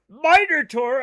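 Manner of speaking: loud, high, drawn-out pun; delighted exclamation. Real, not acted. Streamer relishing a chat pun, delighted exclamation. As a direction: savor the wordplay at full volume.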